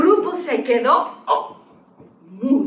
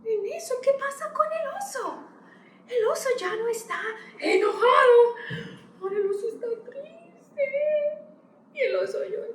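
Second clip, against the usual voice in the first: sad; 11 semitones higher, 4 dB quieter than usual. A narrator's reading. Bear becomes sad in story.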